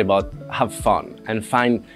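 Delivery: usual speaking voice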